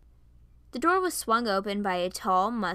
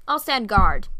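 louder, firm declaration; firm claim; 10 dB louder than usual. Acted. A man claiming guard duty over his friend, loud. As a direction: plant your feet and announce it.

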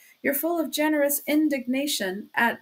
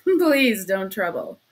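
loud, slow words through a laugh; breaking into laughter; 3 semitones lower, 5 dB louder, slower than usual. A narrator's reading. Suspect refusing help, breaking into hysterical laughter. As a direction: laugh suddenly and push the words out. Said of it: "he suddenly broke into a laugh".

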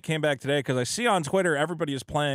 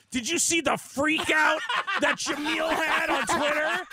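higher, slow, gleeful mock gossip; 8 semitones higher than usual. YouTube guys gloating about her meltdown, slow and high. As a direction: ham up a smirking YouTube guy. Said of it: "the same guys go".

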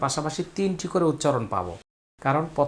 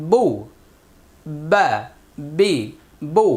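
recitation drill; 2 semitones higher, 5 dB louder, slower than usual. Shown as a demonstration. Teacher leading pupils through Quran letter sounds, loud drill voice.

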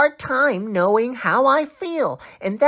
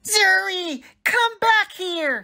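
put-on high, upset child calling after sibling; angry yelling; 8 semitones higher than usual. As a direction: yell after the one who wronged you. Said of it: "and I am yelling".

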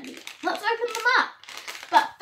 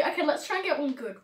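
normal talking voice

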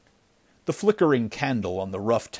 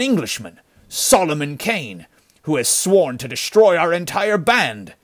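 higher-pitched, worked-up bandit giving a report; agitated bandit; 5 semitones higher, 4 dB louder than usual. Acted. Bandit reporting the band's losses to his chief, agitated. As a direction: report the killings with rising alarm.

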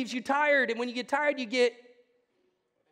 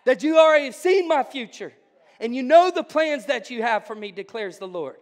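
preaching at full force; 3 semitones higher, 8 dB louder than usual. Real, not acted. Preacher rouses the congregation on surrender, loud and fervent. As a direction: pour it out to the congregation. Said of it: "I'm starting to preach".

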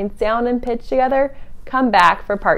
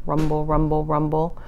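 low, softer rumble; rumbling thunder; 6 semitones lower, 6 dB quieter than usual. Acted. Voicing far-off thunder, low and soft.

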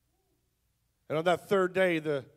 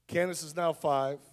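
normal talking voice

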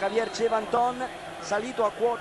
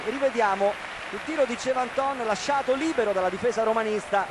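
breathless italian goal commentary; 3 semitones higher, 3 dB louder than usual. Real, not acted. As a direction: celebrate the scorer, voice raised.

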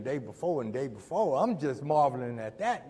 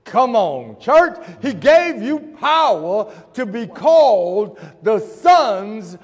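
loud, slow, high preacher's shout; preacher calling out to the church, slow and loud; preaching at full force; 5 semitones higher, 10 dB louder, slower than usual. Acted. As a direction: stretch each word, shouting it to the church.

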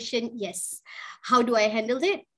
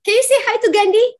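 higher, louder and fast; 8 semitones higher, 8 dB louder, faster than usual. Real, not acted. Urging child to greet guest.

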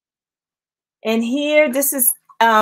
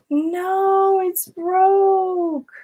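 dismayed; 7 semitones higher, much slower than usual. Real, not acted. Sees bracelet is broken.

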